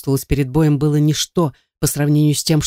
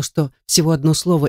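usual speaking voice

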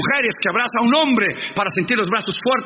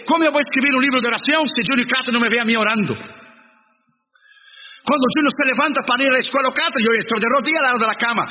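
fervent preaching; 4 semitones higher, faster than usual. Acted. Preacher testifying to the church about praying, fast and fervent. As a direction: drive the point home with rising fervour.

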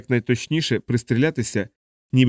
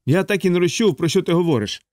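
higher, guileless yell in the ear; Aarfy shouting; 6 semitones higher than usual. A narrator's reading. Aarfy into Yossarian's ear, a high cheerful yell. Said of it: "прокричав Аарфі йому на вухо".

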